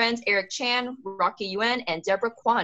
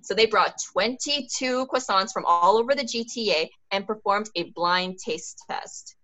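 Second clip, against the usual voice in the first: ordinary talk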